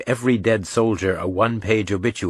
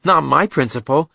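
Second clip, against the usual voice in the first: higher, louder comeback; Reacher, quick retort; 7 semitones higher, 5 dB louder than usual. A narrator's reading. Reacher answering Joe on principle, defiant retort. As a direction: throw it straight back in argument.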